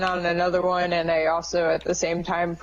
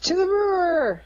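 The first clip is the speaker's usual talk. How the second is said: rallying cry; 12 semitones higher than usual. Acted. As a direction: cheer them onward. Rallying call.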